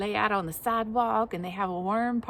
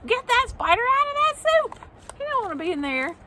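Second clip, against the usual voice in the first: high, loud alarmed squeal; excited shriek; 13 semitones higher, 6 dB louder, faster than usual. Pretend. Shrieking at spider in soup.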